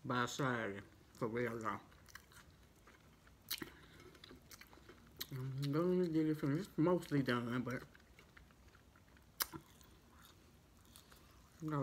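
eating between words; talking while chewing. Real, not acted. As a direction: mouth partly full. Seen 3: Bit food just before; jaw and lips chewing throughout the words.

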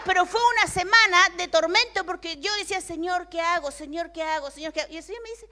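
loud, high preaching voice, slowing on key words; fervent preaching. Acted. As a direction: preach it with rising fervor. Preaches to the congregation.

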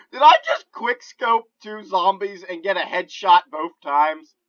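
high, loud, thrilled disbelief; excited yelling. Real, not acted. Player amazed by a double headshot, excited yelling. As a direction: yell it, amazed at your own shot. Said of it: "I'm sorry if I'm yelling".

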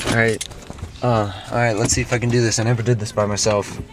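normal talking voice

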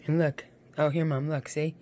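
ordinary talk, nothing marked

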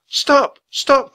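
high, loud urgent plea; crying out. A narrator's reading. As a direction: cry out to halt the stone-throwing. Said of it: "cried Annie".

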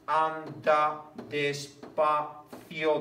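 very slow, syllable by syllable; teacher scanning a verse aloud; slow scansion reading. Shown as a demonstration. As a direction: stretch "anda despacio" evenly.

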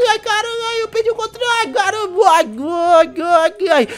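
high, panicked cries; losing control, cry out. Acted. Loses control of the motorbike.

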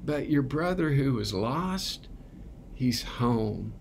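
high, soft, then sinking gentle reply; tender father. Retold or imitated. Father answering his resentful elder son, high and tender. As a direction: reassure him softly, letting it fall. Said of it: "he's like".

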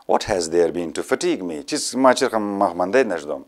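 ordinary delivery

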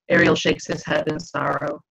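usual speaking voice

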